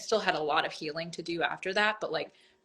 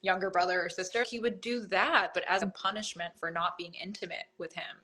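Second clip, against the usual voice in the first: ordinary talk, nothing marked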